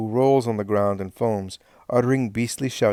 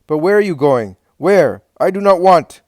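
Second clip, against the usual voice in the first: angry shout; 7 semitones higher, 10 dB louder than usual. A narrator's reading. Helkai stops Daniel leaving.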